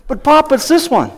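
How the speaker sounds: high-pitched imitation of a child; protesting grandkid. Grandchild correcting grandpa about the sore elbow, high mock protest. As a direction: plead like a hurt kid being misunderstood. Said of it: "they're holding on to their right elbow".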